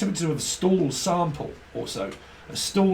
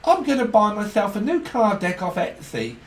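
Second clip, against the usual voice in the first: mock excited shopper; 7 semitones higher, 4 dB louder than usual. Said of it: "Some of you are on Etsy".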